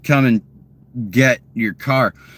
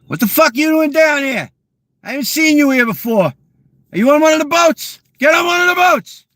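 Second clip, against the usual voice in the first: hammed-up high yell at an outsider to get on a boat; mock angry fisherman; 14 semitones higher than usual. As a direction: bark at the stranger like a hostile local. Imitating angry Cape fisherman.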